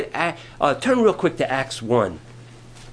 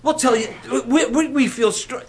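eager pleading; 6 semitones higher than usual. Retold or imitated. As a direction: beg him, thrilled and sure. Disciples pleading with the Lord.

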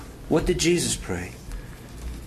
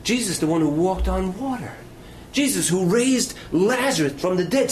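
usual speaking voice

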